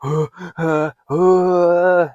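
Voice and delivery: sharp, high panicked gasp for air. Shown as a demonstration. Coach acting out a panic attack, sharp gasp. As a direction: gasp like the panic has hit. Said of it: "you're just like".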